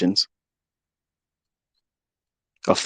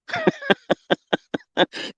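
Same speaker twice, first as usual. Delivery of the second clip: scornful laugh; 3 dB quieter than usual. Real, not acted. High scornful laugh alone.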